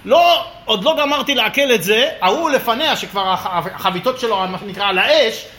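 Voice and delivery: ordinary talk, nothing marked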